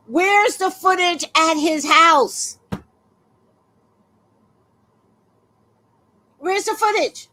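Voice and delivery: loud, slow, high demand; hurling the question at nobody; indignant outburst. Real, not acted. Ranting to viewers about missing footage, loud and high.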